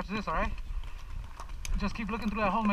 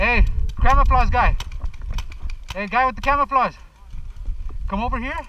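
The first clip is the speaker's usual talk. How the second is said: calling out; 3 semitones higher, 8 dB louder than usual. Real, not acted. Calling to a stranger.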